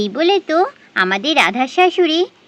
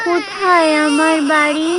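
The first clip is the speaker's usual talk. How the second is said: tearful cry. Acted. Lost Radha breaks down.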